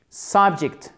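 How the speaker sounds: Subject with SUB punched; stress on first syllable. Shown as a demonstration. Teacher drilling learners, "Subject" stressed on the first syllable. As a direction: hit the first syllable of "Subject", let the second fall. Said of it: "Accent tonique sur la première syllabe".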